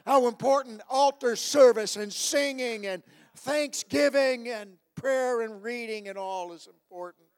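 loud, rising preacher's call; fiery preaching. Acted. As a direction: build it up like a revival preacher. Preaching at full force.

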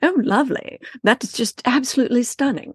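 high put-on posh British accent; mock posh British. Greeting friends on the trip in posh British. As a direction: ham up a British lady in Mexico. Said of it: "It's like".

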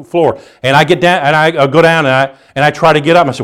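usual speaking voice

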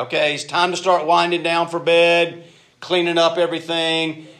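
put-on exasperated dad calling the boys in; calling out to the kids. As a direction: raise your voice over the noise to end playtime.

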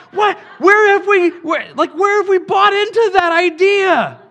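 a preacher shouting at a tame idea of the Spirit. Real, not acted. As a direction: hurl the question at the congregation. Preacher shouting his challenge.

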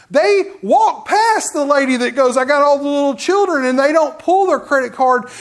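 loud, high fervent preaching; full-force preaching. Acted. Preacher rebuking Christians who walk past, loud preaching. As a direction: drive it home to the congregation.

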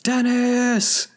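high, drawn-out cry to the sky; anguished yell. Retold or imitated. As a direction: shake your fist and howl the name. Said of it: "shakes his fist to the sky and yells".

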